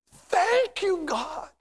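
very high, crying-out thanks; fervent thanks. Acted. Preacher thanking God in heaven, fervent high cry. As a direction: cry it out high, then let it fall. Imagined thanks on meeting Christ.

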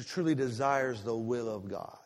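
usual speaking voice

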